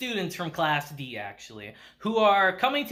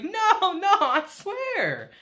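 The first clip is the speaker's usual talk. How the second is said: very high, stretched-out swearing of innocence; hammed-up nervous protest, pitched way up; mock flustered denial; 11 semitones higher, much slower than usual. Kushida sweating as she denies it, high mock-flustered voice. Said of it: "Sweat dropping down her face like".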